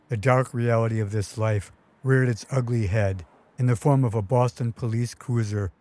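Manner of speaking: plain reading voice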